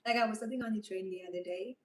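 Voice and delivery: ordinary talk, nothing marked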